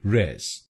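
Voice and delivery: रेस said alone as res; रेस as the syllable res; first syllable res. Shown as a demonstration. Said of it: "आरईएस हम बोलेंगे".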